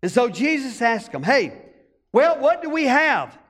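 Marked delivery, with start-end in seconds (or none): none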